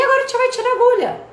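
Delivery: put-on high, quick cheerful voice for a kid; sing-song to a child. Therapist retells speaking to the boy, high sing-song. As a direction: talk brightly to a small child. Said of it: "Eu falei".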